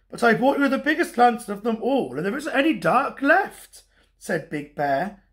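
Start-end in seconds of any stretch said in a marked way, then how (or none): 0.1-3.8 quick, higher-pitched protest; baffled protest. A narrator's reading. Big Bear reasons with Little Bear, quick high baffled protest. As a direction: rush it out, puzzled and insistent.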